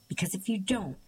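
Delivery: plain reading voice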